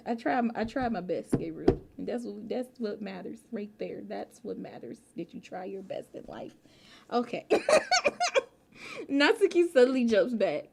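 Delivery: ordinary talk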